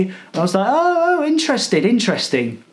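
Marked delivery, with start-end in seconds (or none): 0.7-1.8 mock-excited, much higher, oh interesting; hammed-up sarcastic interest; fake interest. 1.8-2.6 deadpan sarcastic interesting; flat sarcasm. Retold or imitated.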